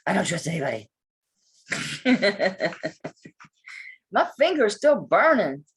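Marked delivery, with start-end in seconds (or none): 1.7-3.3 short run of chuckles; quick rippling laugh; amused laugh. Real, not acted. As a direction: chuckle at your own remark. Laughs after distrust remark.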